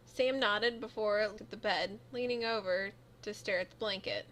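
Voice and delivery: ordinary talk, nothing marked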